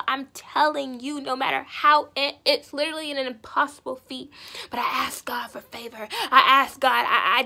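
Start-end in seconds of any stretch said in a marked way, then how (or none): 6.3-7.4 loud, fervent cry; fervent shout. Real, not acted. Testifier crying out to the congregation that she asked God. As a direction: belt it out in full conviction.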